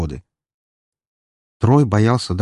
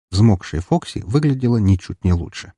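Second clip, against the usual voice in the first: ordinary delivery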